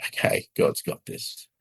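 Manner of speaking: breathless unvoiced whisper through tears; crying. Real, not acted. Husband and wife hearing the verse, whispering through tears. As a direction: let it come out as a high, breathy sob-whisper. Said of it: "started crying and went".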